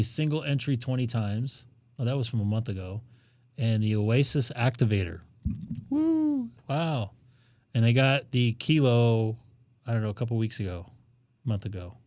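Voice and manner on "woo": high-pitched "woo"; celebratory woo. Real, not acted. Reading out a new award, high woo. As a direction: let out a high little whoop.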